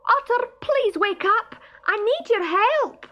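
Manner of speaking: high, loud call to wake a friend; shouting. Acted. Stoat shouting down the loch bank for Otter, loud and high. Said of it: "Barney shouted loudly".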